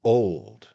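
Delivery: put-on Old clipped short and loud; a curt, stressed Old; emphatic and brief. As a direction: drop Old like a flat verdict. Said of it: "briefly but emphatically".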